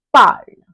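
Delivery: "parle" as one loud falling syllable; pronunciation model. Shown as a demonstration. French teacher shows how the word sounds, pronunciation model of "parle". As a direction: say "parle" loudly as "parl", final e silent. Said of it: "which is again pronounced as".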